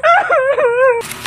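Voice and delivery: high, loud cackle; shrieking victory laugh; gloating laugh. Acted. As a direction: laugh triumphantly at the loser. Winner gloats over the loser.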